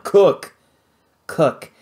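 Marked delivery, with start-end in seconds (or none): 0.0-0.6 loud, higher one-word command; barked order. Acted. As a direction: snap "Cook!" at him like an order. Pirate orders Vlad.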